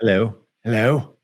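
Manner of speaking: put-on playful English-accent greeting; mock English hello. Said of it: "That's my English accent".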